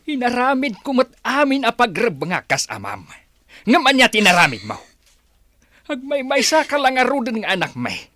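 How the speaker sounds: loud, high-pitched angry tirade; furious scolding. Acted. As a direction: tear into her for the shame. Father scolds disgraced daughter. (nakapungtot a panangbabalaw nga ikkis)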